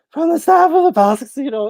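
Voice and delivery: re-enacted high, cracking announcement voice. Floor supervisor on the casino intercom, voice cracking. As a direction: let the pitch jump up and crack, mimicking a botched announcement. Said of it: "my voice cracked so hard".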